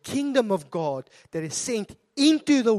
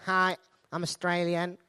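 put-on Australian accent, lower and a little hesitant; 4 semitones lower, 5 dB quieter than usual. South African at customs claiming to be Australian, mock Australian accent. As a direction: try to pass for Australian, not very convincingly. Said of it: "I try and be Australian".

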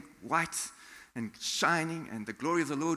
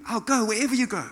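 loud, high burst of eagerness; zealous excitement; 8 semitones higher, 7 dB louder, faster than usual. Acted. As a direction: blurt a gung-ho promise. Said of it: "in excitement, in, in zeal".

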